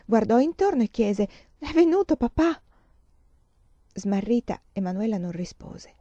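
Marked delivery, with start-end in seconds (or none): 1.6-2.6 sudden high frightened question; startled question. Acted. Feverish girl waking, asking for her father, startled. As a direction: wake with a start and ask. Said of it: "aprì gli occhi come spaventata".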